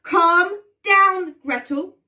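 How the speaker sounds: steady her firmly; Hansel soothing. Shown as a demonstration. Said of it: "Can you say that after three".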